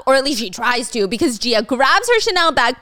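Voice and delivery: usual speaking voice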